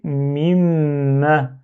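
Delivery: mima said slowly with the middle m hummed long; held middle m. Shown as a demonstration. Teacher pointing out the missing middle sound, slow held m. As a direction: lean on the hum inside mima. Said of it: "c'est ce son qui manque".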